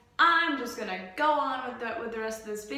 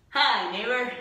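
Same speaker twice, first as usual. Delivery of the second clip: louder call to the neighbor; 8 dB louder than usual. Real, not acted. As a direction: call out a greeting. Greeting neighbor through window.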